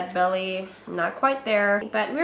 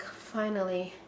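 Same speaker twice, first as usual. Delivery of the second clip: soft, much quieter breath of relief; relieved sigh; 3 semitones lower, 10 dB quieter, slower than usual. Retold or imitated. Relief at finally telling coworkers, soft sigh. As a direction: let it out softly in relief. Relief after announcing pregnancy.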